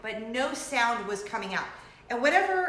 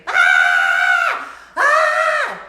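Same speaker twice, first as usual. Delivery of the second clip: full-force piercing "Ah!"; good scream; 15 dB louder than usual. Shown as a demonstration. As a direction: let out a proper loud scream. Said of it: "like a good scream".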